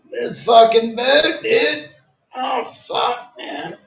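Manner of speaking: high, slow laughing groan about the boot; laughing exasperation. Real, not acted. Streamer griping about his walking boot, high laughing exasperation. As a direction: laugh in pained frustration.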